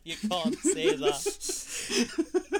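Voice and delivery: high, very slow, through giggles; drawn-out amused scolding; laughing protest. Real, not acted. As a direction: object while helplessly laughing. Scolds friend while laughing.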